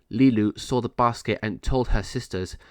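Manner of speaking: ordinary delivery, nothing marked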